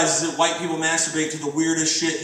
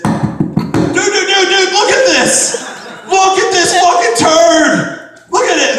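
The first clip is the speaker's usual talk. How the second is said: high, loud, slow excited yelling; laughing yell, pitched way up; excited friend shouting; 14 semitones higher, 9 dB louder, much slower than usual. Retold or imitated. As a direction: shout it like you can't believe your eyes. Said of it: "So I wake up to this".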